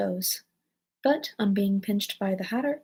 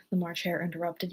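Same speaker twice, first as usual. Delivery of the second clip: ordinary delivery, nothing marked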